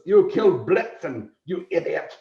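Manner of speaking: a lightly voiced angry Santa scolding; mildly furious Santa. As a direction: scold him like a cross Santa. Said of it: "he goes".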